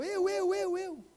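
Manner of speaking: put-on high, eager child's voice volunteering; boy in church eagerly volunteering to pray, high and eager.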